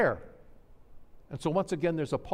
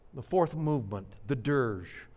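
ordinary talk

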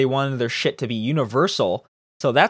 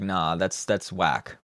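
low, soft mock refusal; imitated dismissal; 8 semitones lower, 6 dB quieter, slower than usual. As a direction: lazily wave it away in a lower voice. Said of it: "They're like".

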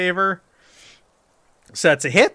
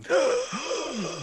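heavy breathing; 11 semitones higher, 5 dB quieter than usual. Shown as a demonstration. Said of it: "should we do something with your breathing".

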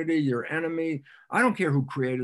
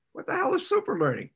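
incredulous; 7 semitones higher, faster than usual. Retold or imitated. Voicing a skeptical questioner.

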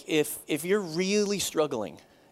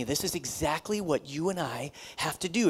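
ordinary delivery

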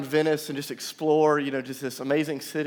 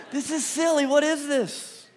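much higher, slow, incredulous scoffing; mock skeptic; 10 semitones higher, slower than usual. Pastor mimicking skeptics watching the baptism, mock incredulous. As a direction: play the baffled doubter. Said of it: "some of you just look at it and go".

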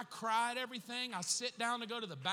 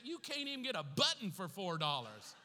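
usual speaking voice

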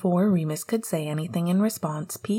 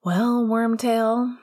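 teasing; 4 semitones higher, 6 dB louder, much slower than usual. Acted. Nostalgic nickname, louder and slower.

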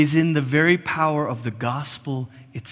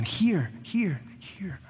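hear, hear, softer each time down to a whisper; fading to a whisper; 11 dB quieter, much slower than usual. Acted. Preacher letting the call to listen fade to a whisper. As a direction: let the word die away into breath.

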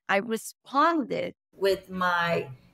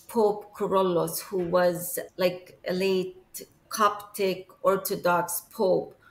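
usual speaking voice

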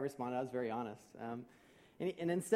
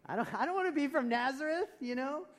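high, loud put-on protest; mock disdain; 9 semitones higher, 5 dB louder than usual. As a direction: whine in hammed-up distaste at the very idea. Voices someone disdaining hick town.

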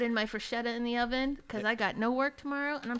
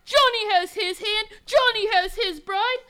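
melodramatic crying; 11 semitones higher, 11 dB louder, slower than usual. Acted. Melodramatic soap scene.

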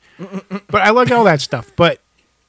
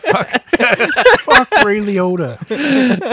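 laughing; 7 semitones higher, much slower than usual. Real, not acted. Repeats the impression, laughing.

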